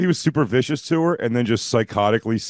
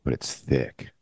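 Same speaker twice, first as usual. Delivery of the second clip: much lower, teasing sultry tone; 8 semitones lower than usual. Pretend. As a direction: drop low and tease. Keeps the sultry bit going.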